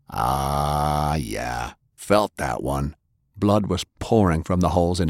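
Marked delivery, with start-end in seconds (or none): 0.1-2.9 a low, slow grunt shrugging off pain; barely bothered by the bullets; low, unfazed brute. A narrator's reading. Maddie shrugging off gunshots.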